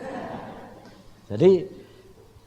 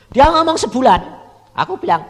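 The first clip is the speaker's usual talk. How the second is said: preaching; 13 semitones higher, 13 dB louder than usual. Acted. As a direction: drive the warning home from the pulpit. Preaching at full force. (khotbah lantang dan lambat)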